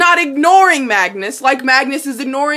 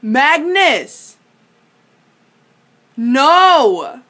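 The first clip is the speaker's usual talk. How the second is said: exasperated call-out; 3 semitones higher, 6 dB louder, much slower than usual. Real, not acted. Scolding a character on screen.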